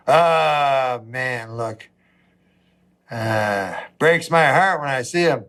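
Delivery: mock pity. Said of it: "pitying onlookers go".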